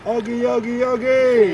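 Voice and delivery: high sing-song intro call; catchphrase call. Acted. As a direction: call out your channel name.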